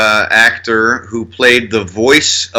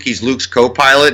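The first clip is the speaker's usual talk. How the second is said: usual speaking voice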